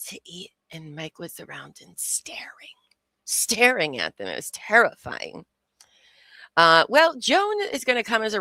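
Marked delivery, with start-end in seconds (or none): 0.1-2.8 hushed whisper, leaning in. Real, not acted. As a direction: drop to a secret whisper. Gossip about a scary man.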